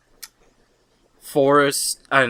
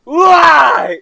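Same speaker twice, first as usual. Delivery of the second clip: high, loud whining "why"; mock whiny shout; 11 dB louder than usual. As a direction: yell it like a sobbing toddler. Imitating crying kid asking why.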